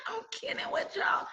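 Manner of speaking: breathy words through laughter; laughing. Real, not acted. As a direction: still laughing as you say it. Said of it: "I crack myself up".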